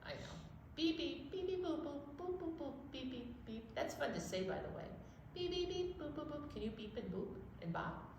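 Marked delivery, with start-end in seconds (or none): 0.8-3.7 high, soft robot beeping; toy robot beeps. Retold or imitated. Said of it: "our noisy robots at the toy shop". 5.3-6.5 high, soft robot beeping; toy robot beeps. Retold or imitated. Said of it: "our noisy robots at the toy shop".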